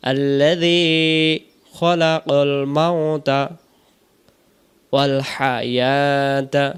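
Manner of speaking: slow, measured chant with drawn-out vowels; Quranic recitation. Shown as a demonstration. Teacher reciting the whole verse for learners, slow chant. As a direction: recite phrase by phrase, pausing, holding long syllables.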